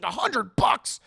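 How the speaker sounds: loud, high incredulous question; hammed-up indignant disbelief; mock outrage. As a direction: balk loudly at the price. [name] balks at the price.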